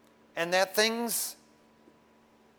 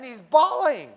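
loud telling; 7 semitones higher, 10 dB louder, slower than usual. Retold or imitated. Story of the crying devil.

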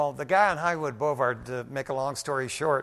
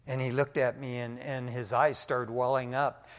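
usual speaking voice

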